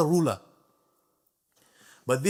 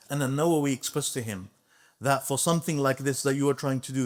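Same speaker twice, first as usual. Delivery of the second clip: normal talking voice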